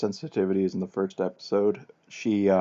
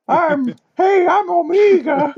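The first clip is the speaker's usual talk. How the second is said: put-on squeaky high kid voice, drawn out; little girl voice; 11 dB louder, much slower than usual. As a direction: pipe it up like a tiny child. Mocking the actress's childlike voice.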